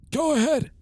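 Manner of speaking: put-on high creaky old man; old man voice, pitched high. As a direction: play a rickety old man. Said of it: "gotta read it in the old man voice".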